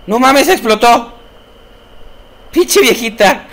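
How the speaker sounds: high, loud astonished yelling; stunned exclamation; shocked outburst. Real, not acted. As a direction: react to the explosion. Woman explodes on screen.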